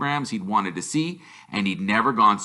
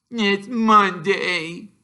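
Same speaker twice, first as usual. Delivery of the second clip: put-on whiny child; 10 semitones higher, 4 dB louder, much slower than usual. A narrator's reading. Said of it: "he told his mother".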